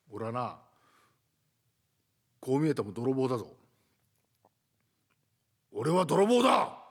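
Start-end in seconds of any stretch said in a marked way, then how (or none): 5.8-6.8 slow and louder, chest out; a thief declaring himself to the sleepy resident, slow and loud; bold declaration. Acted. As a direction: announce it like a threat.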